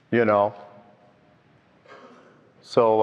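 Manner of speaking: ordinary talk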